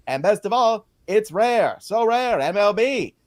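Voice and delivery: put-on high old-timey announcer ad read; 1950s narrator. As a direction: pitch it like a 1950s radio ad. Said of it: "old-timey baseball announcer voice".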